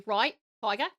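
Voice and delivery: "Right, tiger" in broad Australian; Australian accent. Shown as a demonstration. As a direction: put on an Aussie accent for it. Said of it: "I started to say".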